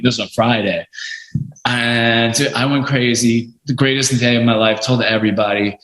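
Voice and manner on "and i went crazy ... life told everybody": plain reading voice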